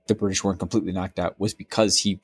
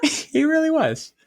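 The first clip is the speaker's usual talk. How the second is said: much higher, slow, still laughing; laughing agreement. Real, not acted. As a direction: gasp it out mid-laugh.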